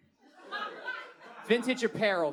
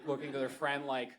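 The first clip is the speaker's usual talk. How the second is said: ordinary talk, nothing marked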